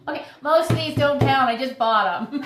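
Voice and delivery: loud, high confession breaking into laughter; laughing admission. Real, not acted. Orchid grower confessing to viewers, loud and laughing. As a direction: own up loudly through a laugh.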